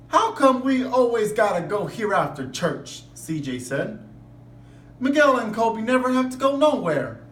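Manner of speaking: lively read-aloud voice; expressive storybook reading; story narration. A narrator's reading. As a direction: read it to the class like a picture book. Reads picture book to class.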